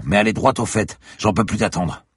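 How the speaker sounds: loud, impatient demand; virulent. Acted. Inspector demanding the professor get to the point. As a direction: press him hard for an answer. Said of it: "cracha un inspecteur virulent".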